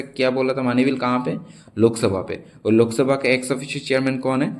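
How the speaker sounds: normal talking voice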